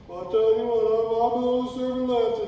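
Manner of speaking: high, loud put-on complaining voice; whining Elijah. Preacher acting Elijah's excuse in a high, whiny voice. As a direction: play Elijah protesting, high-pitched and loud. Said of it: "God don't argue".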